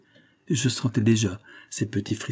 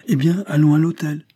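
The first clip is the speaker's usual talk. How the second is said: higher-pitched, scornful consent; disdainful, raised voice; contemptuous; 6 semitones higher, 4 dB louder than usual. A narrator's reading. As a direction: toss it out with contempt. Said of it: "du ton le plus méprisant".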